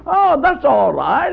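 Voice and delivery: slow, high, easygoing mock nonchalance; unbothered calm. Shown as a demonstration. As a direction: wave off the fire like it's nothing. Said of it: "That should be your attitude".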